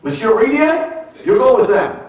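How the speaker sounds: put-on high, clipped French-accented order; French-accented command, high and curt; French official. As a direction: bark it like a French airport official. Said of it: "The guy grabs my passport and says".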